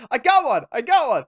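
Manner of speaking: high, loud boast from an excited soldier; mock excited soldier. Soldier who just shot a zombie, mock excited yell. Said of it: "One of them shoots a zombie and goes".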